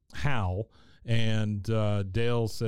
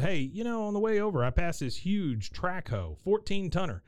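eager Hal; 8 semitones higher than usual. Retold or imitated. Hal comes back with.

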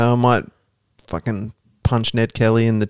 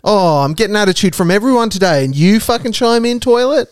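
exasperated; 8 semitones higher than usual. Pretend. Yelling at the toilet.

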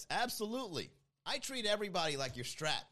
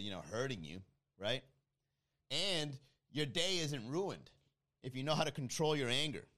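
ordinary talk

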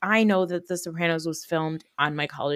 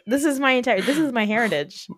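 mock realization; 5 semitones higher than usual. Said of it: "And I was like".

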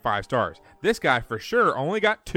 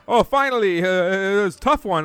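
a hint of a whiny driver, higher; mocking the driver; 5 semitones higher, 4 dB louder than usual. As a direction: ham up the driver's relief. Mocking delivery guy.

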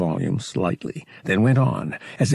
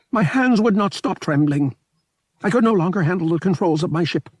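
high, louder small alien voice, weary and unhappy; a sad small alien pilot, pitched up high; mournful little alien; 11 semitones higher, 4 dB louder, faster than usual. A narrator's reading. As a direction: fragile alien pilot lamenting, high and pressed. Alien pilot continues lament.

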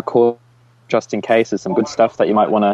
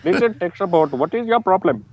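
mock Indian accent, a bit higher; 5 semitones higher than usual. Friend shows his version of the line, mock Indian accent. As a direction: play an Indian tech-support agent. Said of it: "[name] does a good one".

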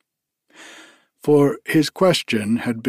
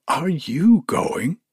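slow, higher, incredulous question; surprised outburst; 6 semitones higher than usual. A narrator's reading. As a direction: ask it in disbelief, pitch swinging up. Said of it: "she broke out".

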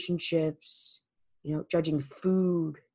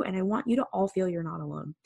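usual speaking voice

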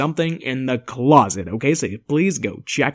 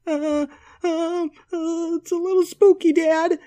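put-on scared child.

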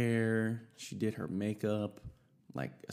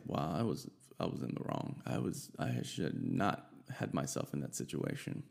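hushed self-reproach; 6 semitones lower, 5 dB quieter than usual. Retold or imitated. Narrator admitting he was wrong, softly to himself. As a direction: drop to a near whisper, owning the mistake. Looking back, admitting own fault.

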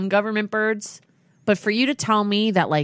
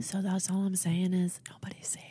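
hushed conspiracy whisper; ominous whisper; 9 dB quieter than usual. Pretend. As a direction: whisper it like a secret warning. Mock ominous conspiracy sign-off.

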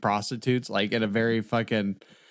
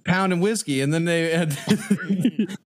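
laughing while talking; 5 semitones higher, slower than usual. Real, not acted. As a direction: talk through the laughter. Laughing through the story.

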